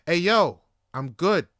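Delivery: acting out patience running out; upset refusal. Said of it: "getting more and more upset".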